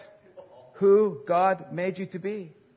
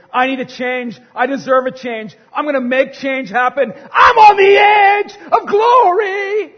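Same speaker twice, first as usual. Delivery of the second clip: building from raised to a much higher, louder slow shout; mock ambitious rally; 8 semitones higher, 12 dB louder than usual. Preacher mocking the ladder climbers, building to a hammed-up triumphant shout. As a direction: pump yourself up until you're yelling.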